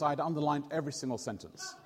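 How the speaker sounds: usual speaking voice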